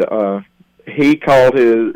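ordinary delivery

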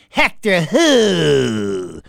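loud, hugely stretched "who"; ugly put-on challenge. Woman answering caller Hector on the phone, ugly and loud. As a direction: drag out WHO in an ugly put-on voice, falling away. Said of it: "she put on this real ugly".

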